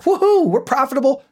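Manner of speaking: high-pitched hammed-up cheer; mock celebration. Business owner cheering a profitable day, mock excited. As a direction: whoop it up in mock triumph.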